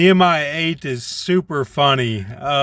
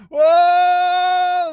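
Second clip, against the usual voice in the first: crowd yelling; 13 semitones higher than usual. Retold or imitated. The people react to the law with a yelling whoa. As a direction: belt it out like a stunned crowd. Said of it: "and they're yelling".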